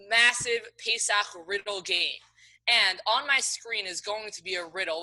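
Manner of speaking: ordinary delivery, nothing marked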